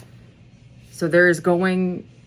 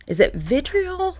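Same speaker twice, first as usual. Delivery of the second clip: much higher pitched "vitriol"; questioning word search; 12 semitones higher than usual. Real, not acted. Unsure of the word to viewers, high rising. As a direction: lift up on the word in doubt.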